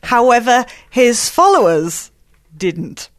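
ordinary talk, nothing marked

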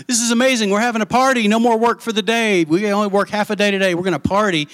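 high, thrilled servant blurting the news; excited servant. Acted. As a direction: gush like an overjoyed servant. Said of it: "You can just see the excitement".

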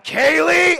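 loud, high shout of alarm; father yelling at daughter sprinting for road, loud; yelling her name. Retold or imitated. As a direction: yell her name as she runs. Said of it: "I was like".

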